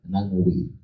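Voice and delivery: ordinary talk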